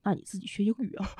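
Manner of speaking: soft, fast comeback; the quiet version of the rant, said to the husband; quiet reply. Retold or imitated. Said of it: "我看着他".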